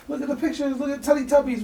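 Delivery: put-on high sing-song baby talk; talking to a toddler. Man distracting the little boy with cartoons, high baby talk. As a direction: coax the kid with a lilting high voice.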